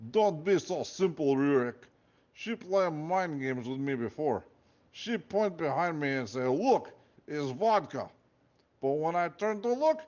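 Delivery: slow, aggrieved Russian guard; guard warning Rurik about her tricks, in a Russian accent. Acted. Guard recounts being tricked.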